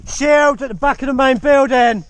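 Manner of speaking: calling out. Real, not acted. Calling out to teammates mid-game.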